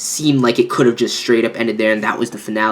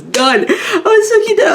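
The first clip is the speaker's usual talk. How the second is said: high squeal through laughter; real laughing squeal; 3 dB louder than usual. Real, not acted. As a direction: crack up at how cute it was. Laughing at cute scene.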